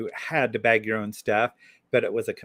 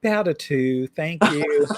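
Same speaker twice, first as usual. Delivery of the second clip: mock posh reply; 6 semitones higher, 4 dB louder, much slower than usual. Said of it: "I'd be like".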